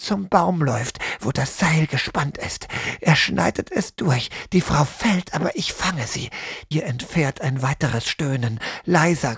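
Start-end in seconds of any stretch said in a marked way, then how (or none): none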